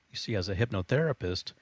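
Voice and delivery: ordinary talk, nothing marked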